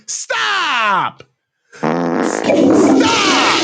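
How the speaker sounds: drawn-out Stop, loud on the second; emphatic stop. Real, not acted. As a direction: shut it down firmly. Rejecting the take.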